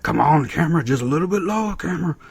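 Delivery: rising, high-pitched begging; hammed-up wheedling; mock pleading. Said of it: "All the guys were like".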